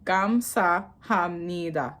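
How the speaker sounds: "Kamsahamnida" slow, each syllable held apart; careful kam-sa-ham-ni-da for "Kamsahamnida". Shown as a demonstration. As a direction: draw out "Kamsahamnida" at half pace. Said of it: "Am I pronouncing that wrong?".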